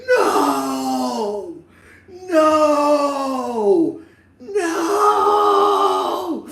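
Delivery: high, loud, crying no no no; hammed-up cry of despair; mock anguished wail. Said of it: "My body was like".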